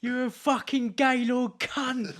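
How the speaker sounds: mock yell. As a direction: spit the insult like an angry driver, slowly. Retold abusive driver's insult.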